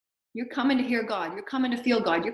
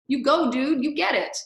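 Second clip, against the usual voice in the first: mock cheering ancestors; 5 semitones higher, 4 dB louder than usual.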